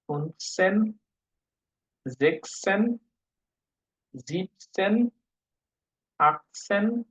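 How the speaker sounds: put-on slow German teen numbers, each word spaced out; reading German numbers aloud to students. Shown as a demonstration. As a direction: model German pronunciation for the class. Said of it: "আমরা বিশ পর্যন্ত শিখব".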